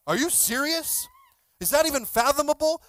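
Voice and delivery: high, loud incredulous questions; mock disbelief. Congregation reacts to the crazy story, incredulous. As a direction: imitate stunned listeners who can't believe it.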